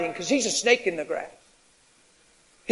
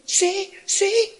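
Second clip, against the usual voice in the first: anxious woman; 12 semitones higher, slower than usual. Retold or imitated. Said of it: "She goes".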